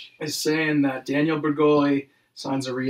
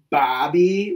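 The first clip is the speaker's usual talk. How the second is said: usual speaking voice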